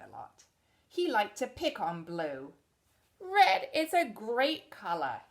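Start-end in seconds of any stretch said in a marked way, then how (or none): 3.2-5.2 a touch of a bragging bully, louder; boastful Red. Acted. Red bragging at Blue, lightly voiced bully. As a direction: puff up a little as Red. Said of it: "he'd say".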